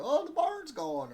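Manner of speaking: hammed-up country lady. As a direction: ham up the lady's line while fishing buddies laugh. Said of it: "look at each other and go".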